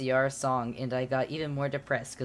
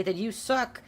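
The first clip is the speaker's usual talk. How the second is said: high mocking jeer; taunting; 9 semitones higher than usual. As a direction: sneer at the haters. Author's note insulting flamers.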